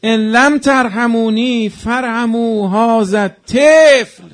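preacher wailing the father's plea; wailing lament. Acted. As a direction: wail it out at full force. Rowzeh climax, Hussein holding baby.

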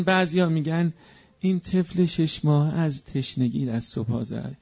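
ordinary talk

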